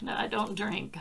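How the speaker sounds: usual speaking voice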